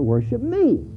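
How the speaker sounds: high, ringing voice of God, slowing at the end; a hint of a commanding divine voice; voicing God. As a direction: proclaim it as God speaking to his people. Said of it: "And God is saying".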